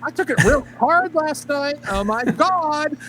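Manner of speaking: high, slow camp lament; a camp voice mocking a dramatic sigh. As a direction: ham up a camp mock grief. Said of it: "You do that a little too well".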